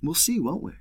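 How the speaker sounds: high, soft, teasing shrug; playful shrug. Retold or imitated. The Lord shrugging off Joseph's question, teasing and soft. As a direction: toss it off lightly, smiling. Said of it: "smiles and shrugs his shoulders and says".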